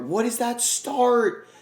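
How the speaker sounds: high, slow stretched exclamation; drawn-out disbelief. Real, not acted. Gamer reacting to his team's awful start, disbelief. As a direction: stretch it out in shock.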